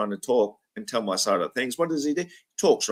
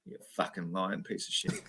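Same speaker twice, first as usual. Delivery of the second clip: low, much softer growl of an insult; muttered; 6 semitones lower, 13 dB quieter than usual. Real, not acted. Host to Craig after mocking him, low muttered insult. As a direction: mutter it under your breath.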